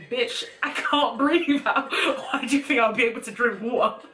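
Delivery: high laughing exasperation; laughing disbelief. Retold or imitated. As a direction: laugh through the outrage at the friend. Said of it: "but I'm thinking".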